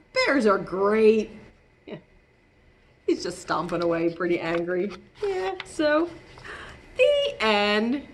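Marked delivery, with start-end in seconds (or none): none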